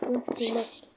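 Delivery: whispered aside. Real, not acted. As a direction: breathe it without voice. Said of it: "whispered aside".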